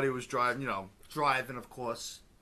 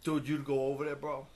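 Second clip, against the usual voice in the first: usual speaking voice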